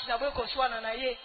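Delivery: usual speaking voice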